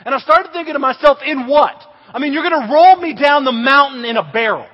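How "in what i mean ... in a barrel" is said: loud, raised-pitch indignant challenge; incredulous protest. Retold or imitated. Scared camper challenging his counselor, loud incredulous protest. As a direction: argue back in mock panic.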